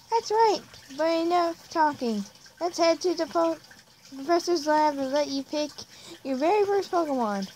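high-pitched put-on mother; mom character. Mom sends child to professor's lab, high motherly voice. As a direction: chivvy the child along in a high voice. Voicing mother in game dialogue.

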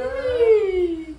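high, loud, drawn-out cheer; real high whoop of delight. Real, not acted. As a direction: cheer loudly for the little ones. Cheering that baby wants the child.

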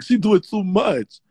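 ordinary talk

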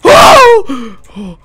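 loud high startled gasp; scared gasp; sharp frightened gasp. Real, not acted. Jump scare.

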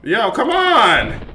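high, slow, drawn-out groan of frustration; frustrated plea. Real, not acted. Gamer failing again, slow frustrated whine. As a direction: stretch it out, fed up.

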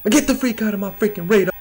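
high, fast panicked rant; frantic, high-pitched order to back off; panicked outburst. Real, not acted. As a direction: rattle it off in alarm. Scared, yelling at game enemy.

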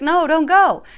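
the crying woman on the card pleads as he leaves, mock loud; imitating a pleading goodbye. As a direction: beg him to stay, hammed up. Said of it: "She's crying. She's like".

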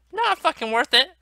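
high-pitched angry cry; sudden high protest; indignant outburst. Real, not acted. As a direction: burst out in exasperation. Outburst about the lost ring.

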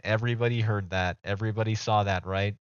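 ordinary talk, nothing marked

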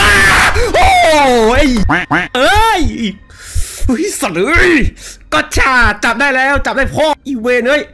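sudden high, loud startled yell. Real, not acted. Gamer jumped by his friend, real startled yelling. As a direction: jolt out a real fright scream. Jump scare from friend Mai.

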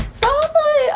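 squeaky high gasp of shock; sudden high-pitched alarm; startled high squeal. Retold or imitated. Shocked realizing it's court.

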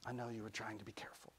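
very soft, quick reassurance; barely voiced comfort; whispered back. A narrator's reading. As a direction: hush it right down to reassure a child. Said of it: "Rennie whispered back".

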